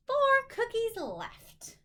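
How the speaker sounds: high, loud big finish; cheerful reveal of the answer; triumphant count. Real, not acted. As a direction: land the answer with a flourish. Counting cookies with kids.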